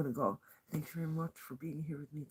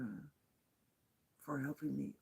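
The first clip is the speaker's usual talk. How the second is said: ordinary talk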